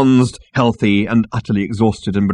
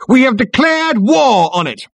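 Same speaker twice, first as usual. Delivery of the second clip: high, loud military shout; ringing battle announcement; triumphant proclamation; 5 dB louder than usual. A narrator's reading. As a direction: declare it to the whole crowd. Soldier proclaims war to crowd.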